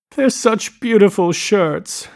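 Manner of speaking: high, slow, tearful character voice; sobbing, as narrated. A narrator's reading. Daisy crying into Gatsby's pile of shirts, acted sobbing. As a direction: act a woman crying over the words. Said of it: "she sobbed".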